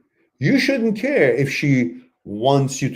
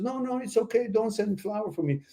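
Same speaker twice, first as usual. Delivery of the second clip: higher and softer, a gentle mock refusal; polite refusal; 8 semitones higher, 7 dB quieter than usual. Host voices a woman turning down flowers, soft and high. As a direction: wave it off sweetly, softly and high. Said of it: "She said".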